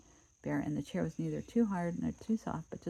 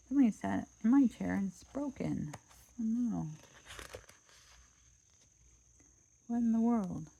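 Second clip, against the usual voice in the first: put-on upset baby bear; 5 semitones higher than usual. Baby Bear finding his broken chair, higher upset voice. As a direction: cry out over the broken chair.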